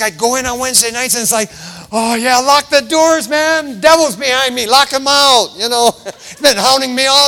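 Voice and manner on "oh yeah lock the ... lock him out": louder, higher mock urgency; acted excited churchgoer. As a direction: call out as if the devil's on your heels. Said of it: "I was so excited".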